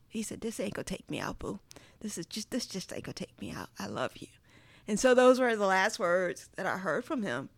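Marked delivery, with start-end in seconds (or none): 0.4-4.3 soft imitation of dad's Southern reassurance; quiet, tender country-dad voice; dad's country accent. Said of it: "my dad has a country accent".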